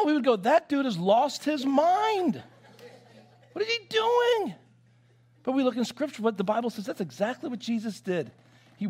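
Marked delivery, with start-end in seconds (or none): 0.3-4.5 high-pitched, incredulous outcry; mock-scandalized, climbing to a squeal; shocked disbelief. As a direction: act out an appalled onlooker, pitch leaping up. Voices congregation shocked at pastor.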